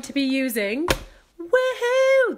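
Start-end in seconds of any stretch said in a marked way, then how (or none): 1.4-2.4 excited. Real, not acted. Cheering about the stamp set.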